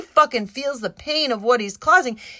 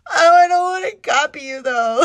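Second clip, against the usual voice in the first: louder, higher, half laughing; laughing protest; 4 semitones higher, 5 dB louder than usual. Retold or imitated. Telling her friend she won't copy her, teary laughing protest. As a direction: protest through a laugh, almost in tears. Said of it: "And I was like".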